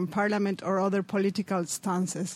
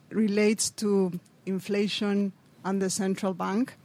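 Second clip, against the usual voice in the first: normal talking voice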